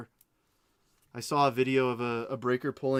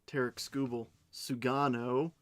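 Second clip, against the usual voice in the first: normal talking voice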